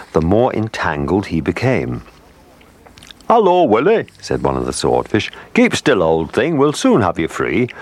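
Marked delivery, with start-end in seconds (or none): 3.3-4.1 high, louder sing-out hello; hearty greeting. A narrator's reading. Swordfish greets the trapped whale, calling out heartily. 5.5-7.7 higher-pitched, hearty storybook reassurance; lightly voiced swordfish. A narrator's reading. Swordfish tells trapped whale rescue is coming, hearty and reassuring.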